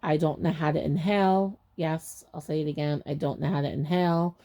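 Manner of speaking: ordinary talk, nothing marked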